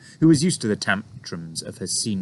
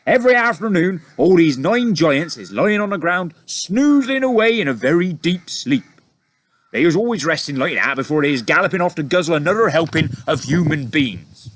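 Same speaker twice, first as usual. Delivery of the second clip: high, loud eager giant; BFG giant voice; 9 semitones higher, 10 dB louder than usual. A narrator's reading. BFG telling the soldiers his plan, eager high voice. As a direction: explain like a friendly giant.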